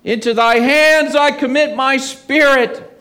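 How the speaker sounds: high, loud cry to the Father. A narrator's reading. Preacher reading Jesus's last cry on the cross, loud and high. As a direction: lift the voice and call out to heaven. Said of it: "crying out with a loud voice".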